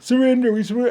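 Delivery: high, whiny mock surrender; squeaky mocking plea; mocking pleading voice. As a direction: mimic them begging to give up. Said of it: "they were finally like".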